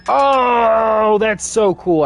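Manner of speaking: high, loud delight; excited. Real, not acted. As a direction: burst out with glee. Figured out chest opening.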